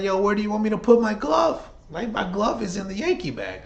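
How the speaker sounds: high, laid-back pitcher voice; casual player voice. Retold or imitated. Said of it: "And Weaver's like".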